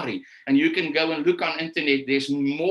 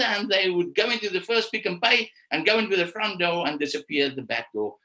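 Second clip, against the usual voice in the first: ordinary talk, nothing marked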